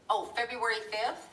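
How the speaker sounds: ordinary talk, nothing marked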